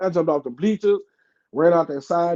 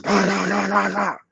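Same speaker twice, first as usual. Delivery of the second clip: imitated gunshots; 3 semitones higher than usual. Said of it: "All I heard was".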